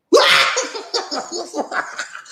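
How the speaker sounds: airy high laugh; breathy high laugh. Real, not acted.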